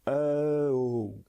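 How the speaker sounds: E sliding slowly down into O; gliding E-O sound. Shown as a demonstration. Teacher showing learners how two sounds join, gliding E-O. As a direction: glide from E to O as one sound. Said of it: "il faut glisser de E à O".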